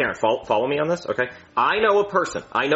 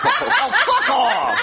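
laughing; much slower than usual. Acted. Annoyed, laughing.

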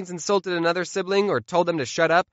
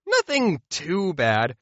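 ordinary delivery

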